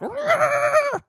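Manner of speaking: high, loud cartoon roar; mock roar. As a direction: roar it like a monster. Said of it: "He's like".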